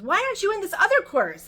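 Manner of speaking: high, loud, pushy question. Retold or imitated. Woman challenges classmate in retelling.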